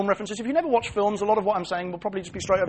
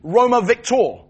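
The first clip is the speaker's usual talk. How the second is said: slow, louder victory cry; 4 semitones higher, 8 dB louder, slower than usual. Retold or imitated. Preacher acts the Roman soldier's cry after battle, slow and loud. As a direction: raise the sword and call out Roma Victor. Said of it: "the cry would go up as he raised his sword aloft".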